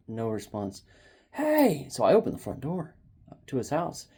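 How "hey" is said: high-pitched call-out; retold screaming. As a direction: imitate hollering into a neighbor's house. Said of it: "and I started screaming".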